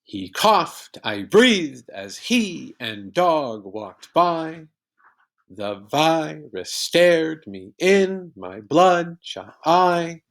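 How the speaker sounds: slow sing-song beat, punching "coughed", "breathed", "he", "dog" and "eye"; exaggerated iambic stress. Shown as a demonstration. Teacher showing how iambic pentameter sounds, exaggerated alternating stress. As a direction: punch every second syllable of "He coughed, I breathed", slowly and weirdly. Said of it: "Here's how it would sound. It sounds really weird".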